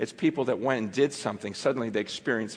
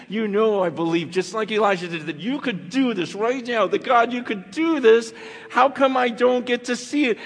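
building frustrated prayer; 9 semitones higher, 7 dB louder than usual. Retold or imitated. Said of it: "almost frustrated with God".

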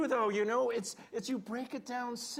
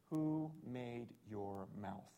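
slow solemn God; 11 semitones lower, 10 dB quieter, slower than usual. Retold or imitated. God questions Moses, slow and soft.

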